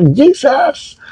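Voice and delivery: high cry of Jesus; demo cry. Shown as a demonstration. Preacher demonstrating the cry to the congregation, high call. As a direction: call the name out high and sudden. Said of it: "kapag sumigaw sila".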